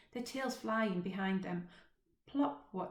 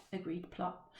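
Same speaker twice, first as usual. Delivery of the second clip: plain reading voice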